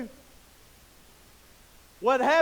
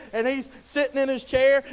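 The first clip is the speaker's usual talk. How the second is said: normal talking voice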